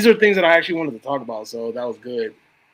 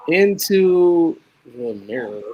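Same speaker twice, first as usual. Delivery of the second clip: drawn-out, eerie reading of "Into the Mirror"; slow spooky title; much slower than usual. Acted. Host teasing a horror movie title, slow and eerie. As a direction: stretch "Into the Mirror" out like a horror trailer.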